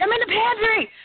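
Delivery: high, panicked retold shout; re-enacted high-pitched freak-out; freaked-out cry. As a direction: call back in a panic from the pantry. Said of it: "I freaked out".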